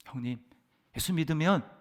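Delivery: ordinary talk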